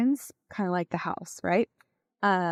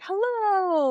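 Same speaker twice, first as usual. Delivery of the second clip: very high, bright-toned greeting; an eager excited hello; happy hello; 12 semitones higher, 3 dB louder than usual. Shown as a demonstration. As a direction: sing it out cheerfully, pitched way up. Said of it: "like, a happy".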